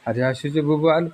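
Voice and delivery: ordinary talk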